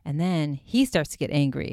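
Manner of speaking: ordinary talk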